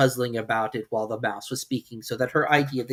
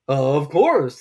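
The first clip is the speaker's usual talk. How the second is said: louder, grave bird voice; dodo voice; 3 semitones higher, 9 dB louder than usual. Acted. Dodo replying gravely, louder.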